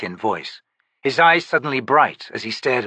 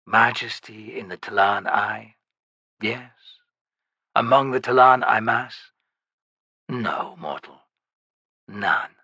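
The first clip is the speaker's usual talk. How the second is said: lightly lowered ancient shaman voice; undead shaman. Acted. Shaman refutes.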